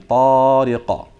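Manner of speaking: loud, sustained Tariqa ending in a short echoing "qa" bounce; qalqala pop. Shown as a demonstration. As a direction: chant Tariqa on a level note, long "taa", popped qaf at the end. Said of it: "So let's try it again".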